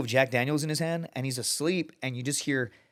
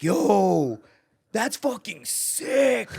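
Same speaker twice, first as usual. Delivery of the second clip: mock stoked dude; 7 semitones higher, 4 dB louder, much slower than usual. Backpacker impressed by war video.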